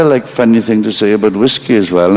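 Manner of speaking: ordinary talk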